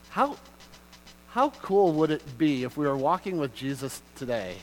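ordinary delivery

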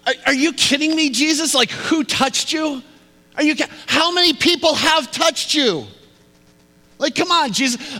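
high-pitched, loud disbelief; acted exasperated outburst, voice way up; incredulous. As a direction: protest as if the question is absurd. Peter's incredulous retort acted out.

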